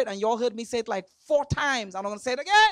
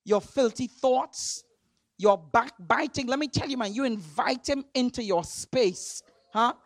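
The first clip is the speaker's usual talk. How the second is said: ordinary talk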